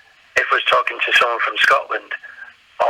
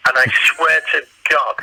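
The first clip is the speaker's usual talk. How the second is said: ordinary talk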